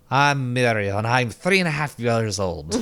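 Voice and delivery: put-on lightly Scottish little girl, proud; a proud wee Scottish lass; proud Scottish child. Retelling a cartoon girl proudly introducing herself, light Scottish accent. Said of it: "very proudly and Scottishly says".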